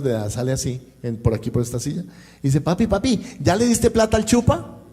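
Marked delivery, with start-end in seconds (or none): 2.6-4.8 put-on high, loud little boy's voice; five-year-old son. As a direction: sing it out like an excited little kid calling his dad. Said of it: "Cuando sale Felipe, recuerde, cinco años".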